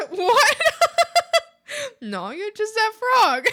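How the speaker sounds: high giggly teasing voice; laughing at frog. Real, not acted. Streamer teasing a frog, laughing.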